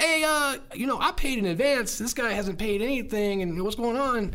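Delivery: high pleading voice. Retold or imitated. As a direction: squeak it out in protest. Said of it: "I'm like".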